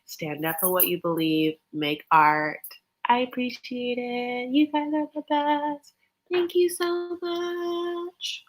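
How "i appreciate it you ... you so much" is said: high, slow, sugary thank-you; high sweet sign-off voice. Acted.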